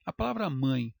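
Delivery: ordinary talk, nothing marked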